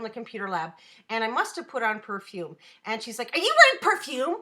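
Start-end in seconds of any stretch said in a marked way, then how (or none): 3.3-4.4 a hint of a snotty girl; mildly snooty accusing question. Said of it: "And really snotty".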